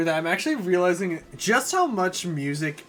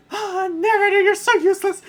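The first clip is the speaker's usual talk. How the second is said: much higher, loud whiny princess; high falsetto princess complaining; 7 dB louder than usual. Acted. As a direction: squeal like a spoiled princess. Peach voice.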